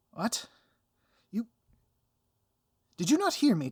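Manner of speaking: loud, high, affronted villain; a hammed-up indignant outburst. Villain outraged the hero is not listening, loud and affronted.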